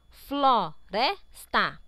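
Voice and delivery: Floresta slowly split as flo-res-ta; syllable by syllable. Shown as a demonstration. Teacher checks how the word is divided, syllable by syllable. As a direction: pull Floresta apart into separate syllables. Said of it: "todas as palavras estão separadas".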